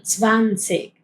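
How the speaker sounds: Zwanzig with a sharp ts start; marked zwanzig. Shown as a demonstration. Teacher stressing the ts sound in Zwanzig. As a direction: hit the ts of Zwanzig hard. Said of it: "va a ser bien marcado".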